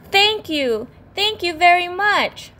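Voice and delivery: high, bright thank you; overjoyed. Acted. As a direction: bubble over with thanks. Little fish overjoyed.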